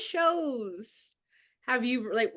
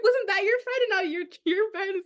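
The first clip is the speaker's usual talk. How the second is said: incredulous; 7 semitones higher, faster than usual. Retold or imitated. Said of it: "I'm like".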